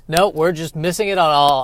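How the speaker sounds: usual speaking voice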